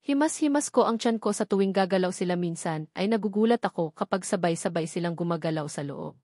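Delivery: usual speaking voice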